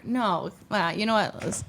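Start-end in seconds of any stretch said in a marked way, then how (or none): none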